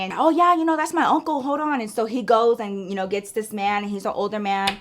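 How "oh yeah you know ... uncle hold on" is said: higher, eager retold reply; lightly voiced shop guy. Guy at the garage fetches his uncle, lightly imitated.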